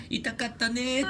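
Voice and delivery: put-on soft cooing, like comforting a baby; soothing baby talk. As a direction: coo it gently to a hurt child. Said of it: "赤ちゃんをあやすように".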